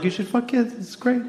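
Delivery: high mocking voice of eager people; squeaky pitch-up mockery. People urging him, in a high mocking voice.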